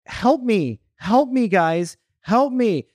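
mock plea for help. Imitating man's fake plea.

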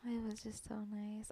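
soft breathy whisper. Real, not acted. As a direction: whisper it, touched. Tender memory of flowers.

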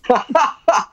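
a bright, high laugh bursting out; high burst of laughter. Real, not acted. Laughing at the host's album joke. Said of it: "Funny, man".

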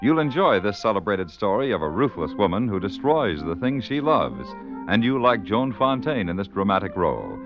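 normal talking voice

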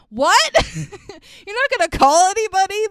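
disbelieving yelp, up high and loud; can't believe what she's hearing; laughing disbelief; incredulous. Real, not acted. Incredulous outburst at the mom.

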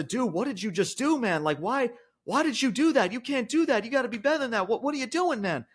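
high-pitched, pleading retelling of a distressed brother; distraught protest. Retold or imitated. Baffled brother confronting Jimmy after the kick, retold distraught high-pitched protest. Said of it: "Jey Uso is in distraught".

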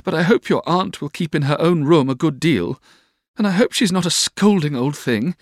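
put-on high girlish character voice; Dora's anxious plea. A narrator's reading. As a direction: voice a fretful young woman, pitched high. Dora voiced in the story.

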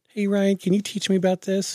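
high put-on girl voice; imitating a girl asking for help; random girl. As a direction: ask in a high, girlish voice. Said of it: "some random girl just".

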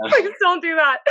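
high, loud alarmed plea; laughing shout. Real, not acted. Reacting to wrestling a bear.

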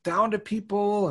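imitating his childhood self, lightly upset; upset kid. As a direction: whine like an indignant young fan. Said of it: "and I'd be like".

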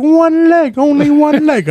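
very high mock scolding in an accent; mimicked yelling. Massage worker objecting to being pinned, in high mock yelling. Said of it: "She was yelling at me".

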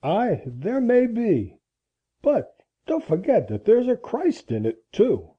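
put-on slow, insistent old man; tense retort. A narrator's reading. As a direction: answer back with tense deliberation. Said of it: "retorted old Abel in a low, tense tone".

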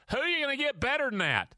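quick, high-pitched challenge falling at the end; rhetorical challenge, not a question. Acted. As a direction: drive the point home as a statement disguised as a question. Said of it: "See, that's not a question".

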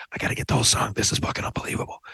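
soft unvoiced whisper while filming; awed whisper. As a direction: whisper so the recording stays clean. Whispering while recording the song.

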